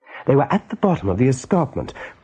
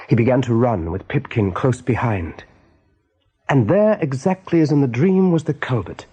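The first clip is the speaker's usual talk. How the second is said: ordinary talk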